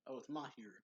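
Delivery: ordinary talk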